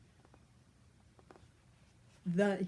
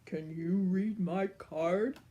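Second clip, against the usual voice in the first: grand giant. Acted. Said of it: "said the giant grandly".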